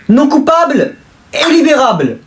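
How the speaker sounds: high, slow, solemn judge's pronouncement; pompous verdict. Acted. Judge declares the rich son not guilty, solemn and slow. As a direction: proclaim it from the bench.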